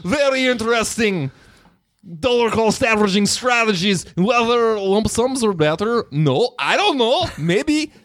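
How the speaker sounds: high, hammed-up Russian accent; thick put-on Russian accent. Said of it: "we'll do it in Russian accents".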